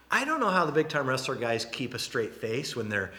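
ordinary delivery

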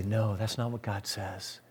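low, soft and slow rebuttal; soft, low, slow firm correction; quiet firm no. Acted. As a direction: say it softly but with weight.

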